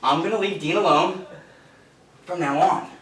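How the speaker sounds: barked command, high and loud; shouted order. Acted. Bully forcing the writer to repeat after him, shouting.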